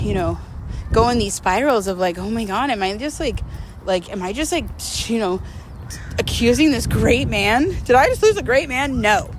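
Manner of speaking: usual speaking voice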